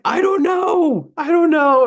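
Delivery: high, loud, drawn-out cry of helplessness; panicked reply. Retold or imitated. Salesman answering his yelling boss on the phone, panicked. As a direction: wail it back at the top of the voice.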